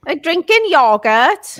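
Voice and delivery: Swansea accent. Shown as a demonstration. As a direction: lay on the Swansea accent, slowly. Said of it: "say it with your Swansea".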